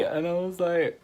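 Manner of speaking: normal talking voice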